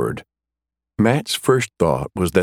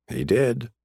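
ordinary delivery

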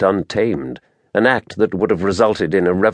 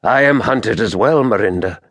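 ordinary delivery, nothing marked

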